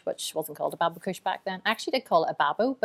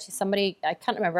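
usual speaking voice

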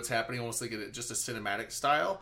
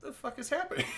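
squeaky mock disbelief; retold bewilderment; 12 semitones higher, 4 dB quieter than usual. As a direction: act out your own confusion, laughter creeping in. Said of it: "I'm like".

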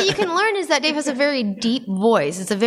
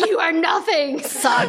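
higher, snarling mock domination; mock dominant put-down; 6 semitones higher, slower than usual. Host playing dominant partner, mock sneer. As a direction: play-act the dominant one, sneering. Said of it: "be like".

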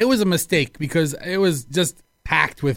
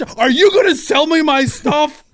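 louder, higher demanding; belligerent customer; 7 semitones higher, 5 dB louder than usual. Retold or imitated. Returning customer confronting clerk, loud and high. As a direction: bark the demand. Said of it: "he starts".

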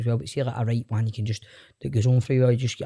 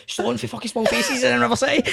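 excited, high-pitched; 11 semitones higher than usual. Retold or imitated. Excited text to mates.

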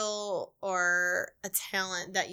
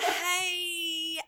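high, drawn-out country greeting; slow Southern country; 7 semitones higher, 4 dB quieter than usual. Pretend. Southerner playing dumb on a call, slow country voice. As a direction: play up a slow Southern drawl. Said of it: "and talk real slow and real country".

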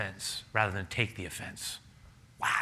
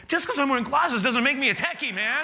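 louder, higher hammed-up protest; mock indignant retort; 14 semitones higher, 5 dB louder than usual. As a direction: play up the offended comeback. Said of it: "I wanna go".

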